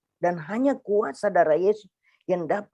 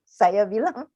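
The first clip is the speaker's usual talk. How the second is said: normal talking voice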